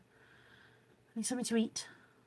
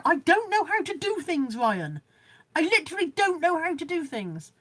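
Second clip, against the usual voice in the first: flustered; 6 semitones higher, 3 dB louder than usual. Real, not acted. Flustered at doing tech.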